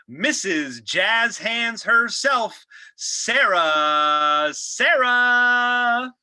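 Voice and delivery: host hyping the guest's entrance; big announcer build-up. Acted. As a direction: announce the guest like a game show.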